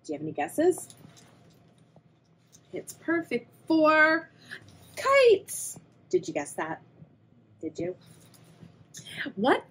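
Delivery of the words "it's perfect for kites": excited reveal. Acted. Reveal answer.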